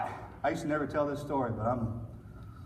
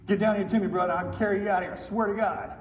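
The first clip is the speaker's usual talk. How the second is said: raised, higher voice calling up the slope; 5 semitones higher than usual. Retold or imitated. Soldier calling to his wounded buddy to come down, raised and pleading. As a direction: call out to him, pleading.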